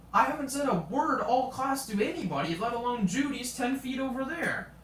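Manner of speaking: high-pitched, retold self-defence; indignant protest. Retelling own protest to teacher.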